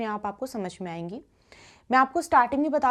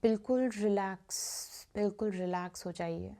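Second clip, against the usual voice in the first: guided meditation; 2 semitones lower, 6 dB quieter than usual. Acted. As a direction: guide listeners gently into stillness, softening on repeated phrases. (धीमी, शांत ध्यान कराने वाली आवाज़)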